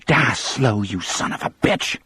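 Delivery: venomous, half-voiced rasp; bitter woman spitting a curse; spat curse. A narrator's reading. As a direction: spit the curse out with hatred. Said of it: "the woman spat".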